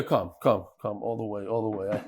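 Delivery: coaxing. Real, not acted. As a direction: gently wave him in with a soft voice. Coaxing boy forward.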